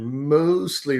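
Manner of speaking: MOSTLY drawn out slowly; heavy, slow emphasis on "mostly"; stress on MOSTLY. Real, not acted. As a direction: lean hard on "mostly", stretching it. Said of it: "emphasis on the word mostly".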